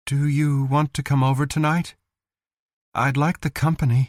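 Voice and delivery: tentative. Acted.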